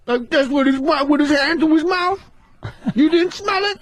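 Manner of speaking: very high and incredulous, hand to mouth; Tracy impression. Retelling the PCP moment, as Tracy high and disbelieving. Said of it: "He gave you unbeknownst".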